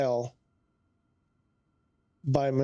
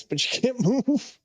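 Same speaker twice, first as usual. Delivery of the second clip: laughing; 9 semitones higher than usual. Real, not acted. Laughing at the stuck plane.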